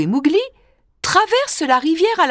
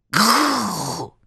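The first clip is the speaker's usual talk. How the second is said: tiger growl; 2 semitones lower than usual. Acted.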